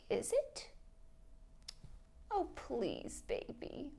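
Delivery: breathy, whispered guilt-trip plea. Acted. Mother pleading with her son to call, whispered plea. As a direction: whisper it to make him feel guilty.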